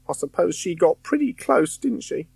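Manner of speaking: high, cheeky cockney lad; chirpy ghost friend teasing; cockney ghost boy. A narrator's reading. William the ghost reassuring Roddy, cheeky and high.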